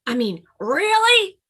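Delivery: really? high and loud, bursting out. Acted. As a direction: blurt really? out eagerly. Grizzly Bun asks again louder.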